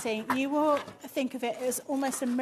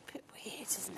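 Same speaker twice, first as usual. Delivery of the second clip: hushed whisper, no voice; 13 dB quieter than usual. Real, not acted. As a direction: whisper it as an aside. Quiet aside to cohost.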